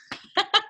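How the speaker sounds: high embarrassed giggle. Real, not acted. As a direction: giggle at your own slip. Laughs at own slip.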